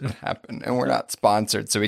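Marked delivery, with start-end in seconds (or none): 0.0-0.6 breathless whispered aside; hushed mock echo. Real, not acted. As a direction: whisper it back under your breath. Host echoes under breath.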